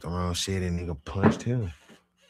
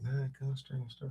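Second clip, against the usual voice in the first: faint protest; 8 semitones higher, 8 dB quieter than usual. Retold or imitated. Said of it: "He like".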